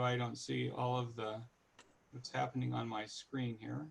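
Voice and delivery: usual speaking voice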